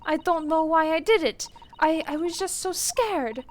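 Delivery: put-on high, frightened girl's confession; pleading, scared and guilty; scared young woman. Reads scared character's lines.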